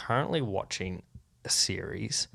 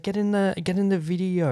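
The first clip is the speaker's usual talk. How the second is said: high-pitched mock urging of a mum; a hint of a pushy mum calling her son into frame; imitating the mum; 9 semitones higher, 4 dB louder than usual. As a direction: hustle the kid into the shot in a lightly put-on high voice. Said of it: "She's like".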